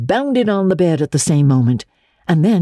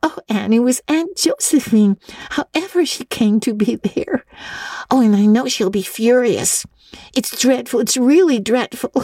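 gasping with laughter; 9 semitones higher than usual. A narrator's reading. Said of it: "gasping with laughter".

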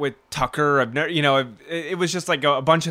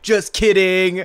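mock gotcha; 4 semitones higher, 6 dB louder than usual. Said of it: "he's like".

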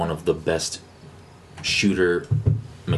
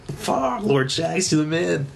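excited cry; 9 semitones higher, slower than usual. Retold or imitated. Gamer gushing to Lord Shaxx, high and thrilled. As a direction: gush up at the hero, pitch jumping. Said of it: "and I'm, I'm like".

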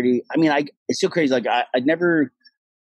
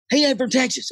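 Texan host; 14 semitones higher than usual. Retold or imitated. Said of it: "He's like".

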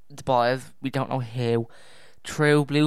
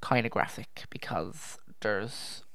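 hushed warning; 2 semitones lower, 7 dB quieter than usual. Real, not acted. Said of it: "now here is where it's going to get kinda graphic".

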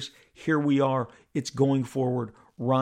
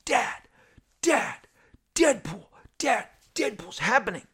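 son yelling upstairs; 9 semitones higher than usual. Retold or imitated. Son yells up to dad that Deadpool's happening, high excited call. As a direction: shout it up the stairs, much higher, like an excited fourteen-year-old. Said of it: "my son yells up from his room".

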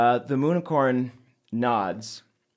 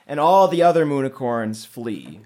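normal talking voice

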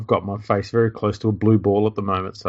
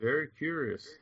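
faint, far-off voice; quiet mic. Real, not acted. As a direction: sound distant from the mic. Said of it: "you sound real quiet".

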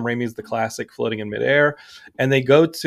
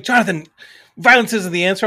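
mock scolding wife; 6 semitones higher, 4 dB louder than usual. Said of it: "Heidi's now yelling at me like".

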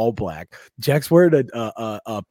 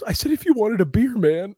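mocking heckler; 10 semitones higher than usual. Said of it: "He goes".

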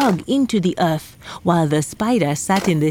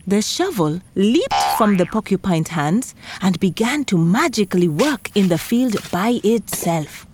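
plain reading voice

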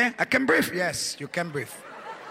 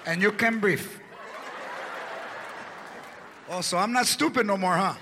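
mocking wife; 5 dB quieter than usual. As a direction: rub it in with a laugh. Wife mocking sick husband.

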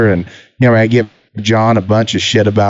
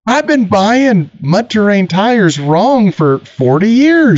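dumbfounded; 10 semitones higher, slower than usual. Retold or imitated. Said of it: "And I'm like".

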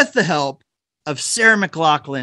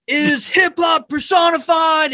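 hammed-up [name]; 11 semitones higher, slower than usual. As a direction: hype it up like a rapper bursting in. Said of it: "[name] is like".

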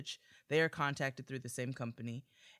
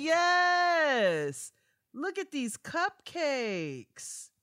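hyped praise; 11 semitones higher, 7 dB louder, much slower than usual. Real, not acted. Hyping the cupcake pics.

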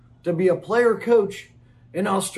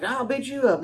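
mock tough guy; 6 semitones higher than usual. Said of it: "Just a bunch of guys standing around like".

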